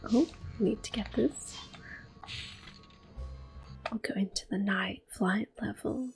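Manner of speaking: normal talking voice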